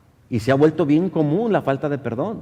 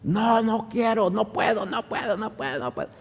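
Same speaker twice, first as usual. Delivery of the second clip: possessed refusal; 8 semitones higher than usual. Retold or imitated. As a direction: act out anguished refusal, pitched up then trailing away. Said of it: "Hasta la voz le cambió". (negativa angustiada imitada, aguda y alargada)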